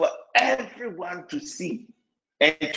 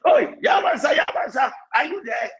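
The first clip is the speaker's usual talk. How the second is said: caller on the phone; 7 semitones higher than usual. Retold or imitated. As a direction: shout a name into the receiver as if the call is still open. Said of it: "Then the phone will talk".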